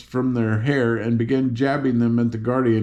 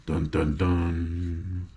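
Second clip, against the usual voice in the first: low, soft sung dun dun dun; playful ominous sound effect; mock dramatic sting; 6 semitones lower, 7 dB quieter, much slower than usual. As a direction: hum an ominous sting under the breath. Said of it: "dramatic sting voiced by reader".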